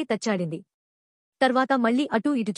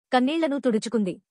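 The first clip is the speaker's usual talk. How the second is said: ordinary delivery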